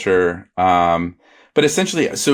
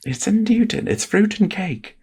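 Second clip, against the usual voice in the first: high sing-song ad voice; 14 semitones higher than usual. Pretend. Quoting the old cookie commercial in high sing-song. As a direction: sell it like an old TV ad. Ad slogan parody.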